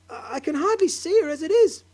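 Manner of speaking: high, loud stammering wail. Acted. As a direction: wail it in despair. Wife wails in despair.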